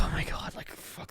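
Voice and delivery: breath of disbelief after a close call; hushed swearing in relief; retold whispered relief. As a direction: whisper it to yourself, re-enacting huge relief. Retold relief as plane takes off.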